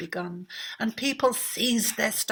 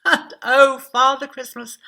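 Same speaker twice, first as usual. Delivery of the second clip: much louder, higher exclamation; joyful cry; 4 semitones higher, 11 dB louder than usual. A narrator's reading. Narrator blessing Father Christmas, joyful and loud. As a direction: cry it out in delight.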